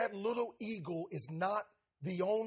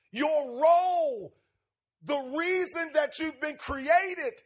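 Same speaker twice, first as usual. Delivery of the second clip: owl voice rising higher and louder to soar; building exhortation; 6 semitones higher, 6 dB louder, slower than usual. Acted. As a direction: build it up like a preacher's climax.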